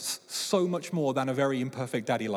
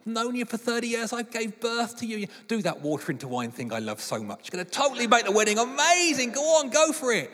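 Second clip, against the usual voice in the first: mock pleading mum; 6 semitones higher than usual. Preacher playing Mary coaxing Jesus, mock wheedling. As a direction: wheedle like a mum badgering her son. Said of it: "Mary is coming to Jesus and saying".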